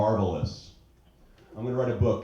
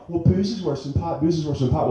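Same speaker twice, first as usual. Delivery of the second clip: mocking the argument; 6 semitones higher, 4 dB louder than usual.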